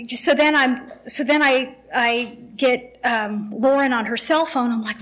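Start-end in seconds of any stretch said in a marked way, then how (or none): none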